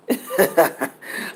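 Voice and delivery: a short breathy chuckle; amused little laugh at yourself; light laugh. Real, not acted. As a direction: laugh off your own rush. Laughs at own speeding up.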